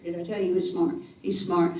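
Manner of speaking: ordinary delivery, nothing marked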